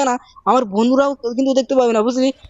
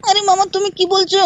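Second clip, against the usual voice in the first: put-on high, pleading little-boy voice; Shinchan child voice; 9 semitones higher than usual. Shinchan nagging his uncle, high childish voice. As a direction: pitch way up and whine at mama like a small kid.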